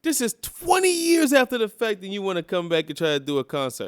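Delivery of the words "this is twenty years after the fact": high, loud exasperation on twenty; indignant outburst. Real, not acted. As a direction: burst out high and loud in disbelief. Indignant rant about a concert.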